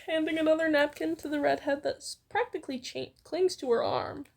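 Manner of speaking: normal talking voice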